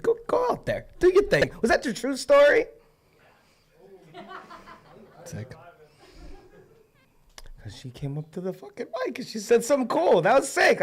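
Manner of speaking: host praising Meredith after her poem, excited; excited praise. Real, not acted. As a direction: rave about the poem. Host cheering poet's true story.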